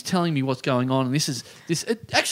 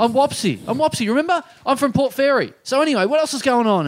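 eager stranger; 9 semitones higher than usual. Retold or imitated.

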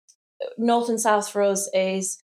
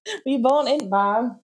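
echoing the Yorkshire "born in Barn"; Yorkshire accent; 4 semitones higher than usual. Shown as a demonstration. Daughter copying mum's Yorkshire saying. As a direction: repeat it with a light Yorkshire lilt. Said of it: "that's more Yorkshire accent".

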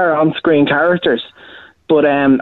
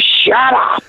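pitched-up mock Vince McMahon bark; a hint of Vince McMahon, higher; Vince McMahon impression; 8 semitones higher than usual. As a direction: snap it like a hammed-up Vince. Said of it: "I have a Vince kind of".

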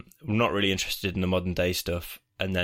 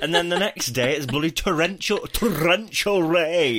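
northern middle-aged woman; 7 semitones higher, 4 dB louder than usual. Acted. Host grumbles about torrential rain, mild northern woman voice. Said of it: "I go so northern when I'm doing this".

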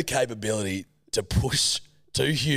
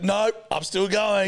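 put-on defiant kid; 8 semitones higher, slower than usual. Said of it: "And you're like".

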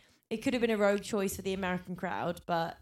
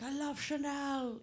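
higher, slow, put-on posh fashionista; drawn-out, one-note luxury swoon; Patsy voice; 7 semitones higher, 4 dB quieter, slower than usual. Host mocking the one-note Ab Fab Patsy, high and slow. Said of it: "playing the Ab Fab character".

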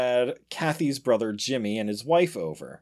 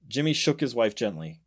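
ordinary delivery

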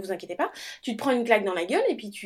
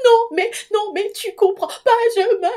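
much higher, louder, incoherent sobbing rage; raging through tears; 12 semitones higher, 7 dB louder than usual. Retold or imitated. Exhausted new mother raging at her husband, tearful and incoherent. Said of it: "et je rage, je fais".

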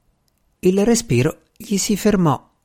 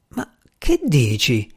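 slow, halting bewilderment; falter, thrown by what you've just heard; disconcerted stammer; slower than usual. A narrator's reading. Baffled visitor questions his host, stammering. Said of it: "balbettai sconcertato".

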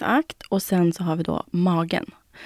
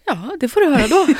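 put-on teasing boyfriend voice; 8 semitones higher, 6 dB louder, faster than usual. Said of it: "Han bara".

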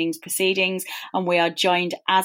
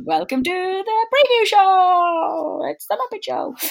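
high, slow, strained frog puppet voice; Kermit the Frog; 13 semitones higher, 3 dB louder than usual. Pretend. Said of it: "my rather unsuccessful Kermit the Frog impression".

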